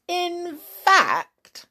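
ordinary delivery, nothing marked

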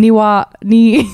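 Halloween said backwards, "Niwa-" slow; backwards speech. Shown as a demonstration. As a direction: spell Halloween in reverse sounds. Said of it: "That's how you say Halloween backwards".

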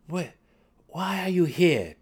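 stammered, startled question; stammering. A narrator's reading. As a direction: trip over the first word. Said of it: "Drizzt stammered at her".

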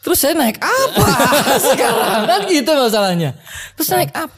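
chuckling through the question; laughing. Real, not acted. Telling a friend his car was taken, laughing. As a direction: laugh while asking what he'll ride now.

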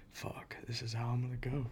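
imitating a scared whisper, unvoiced; retold whisper. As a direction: whisper it like a defeated man. Scared friend's resigned thought.

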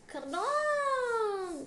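high, loud drawn-out kerplong; comic crash sound. A narrator's reading. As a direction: sing out the crash, high and long. Story's crash sound acted out.

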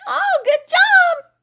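high, sugary fake praise with a cracking laugh; mock cheerful mom. Mom fake-praising her kid's painting, high and strained. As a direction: gush over the kid's painting through gritted teeth. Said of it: "so it was like".

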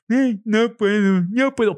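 high, silly finger-in-nose whine; mock your own words in a high, dopey voice; ridiculous high nitwit tone; imbecile voice. Shown as a demonstration. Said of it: "Pero así, con ese tono".

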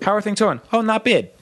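high, mock East Coast "not bad"; lightly voiced Jersey relative; East Coast small talk. Imitating wife's Philly family small talk, high. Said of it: "such an East Coast thing".

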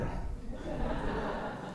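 a quiet airy laugh at himself; soft breathy laugh. Real, not acted. Laughing to the congregation about crying as a kid, soft airy laugh. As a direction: let a silent laugh slip out.

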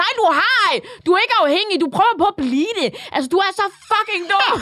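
high, mock-outraged rebuttal; exasperated retort. Imagined retort to a vaping boy, exasperated. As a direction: tear down the pose, on the edge of laughter.

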